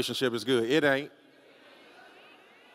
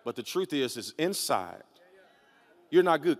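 ordinary talk

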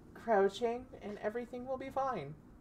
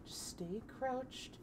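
calm yourself down quietly; hushed reassurance; 9 dB quieter than usual. Real, not acted. Hiding, whispered self-reassurance.

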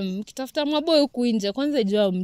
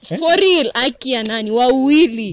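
excited; 3 semitones higher, 8 dB louder than usual. Real, not acted. Insisting it really happened.

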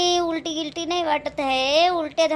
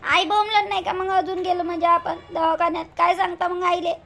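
raised, higher protest to the husband; protesting loudly; 5 semitones higher, 5 dB louder than usual. Acted. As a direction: push back hard, voice up. Wife protesting to her husband. (नवऱ्याला मोठ्या आवाजात विरोध)

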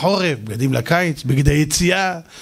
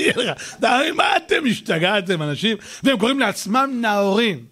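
ordinary talk

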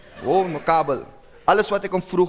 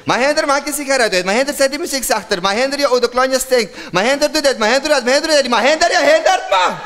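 high, loud whiny nagging wife; 8 semitones higher, 4 dB louder than usual. Retold or imitated. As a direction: nag him over and over, getting shriller. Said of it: "Marie was prenataal depressief".

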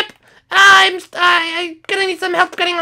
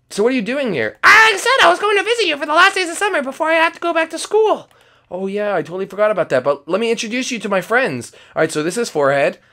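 much lower, quicker character voice; Forehead voice; 8 semitones lower, 3 dB quieter than usual. Acted. Forehead greeting Skittles, low deep voice. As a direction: rumble it deep like a big guy.

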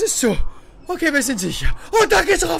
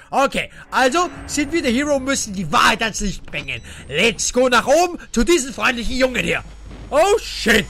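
usual speaking voice

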